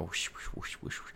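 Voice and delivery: soft breathy whoosh sounds; quiet imitated wind whoosh; whooshing noise. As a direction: blow out little airy whooshes. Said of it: "a little whooshing noise".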